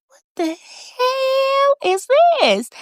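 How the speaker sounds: high, slow, drawn-out disbelief; shocked outburst. Retold or imitated. Viewer reacting to a gruesome delivery scene, high shocked disbelief. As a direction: stretch it out high like you can't believe your eyes.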